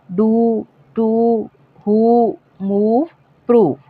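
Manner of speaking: डू टू हु मूव प्रूव with a long oo, slow. Shown as a demonstration. Teacher drawing out long oo words for students. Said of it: "बड़ा ऊ pronounce करते हैं".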